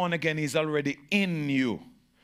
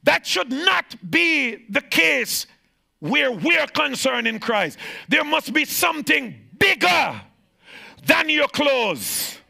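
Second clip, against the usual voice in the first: high, loud preacher's shout; full-force preaching; 10 semitones higher, 6 dB louder, slower than usual. Acted. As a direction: preach it out with fire.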